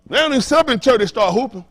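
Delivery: ordinary talk, nothing marked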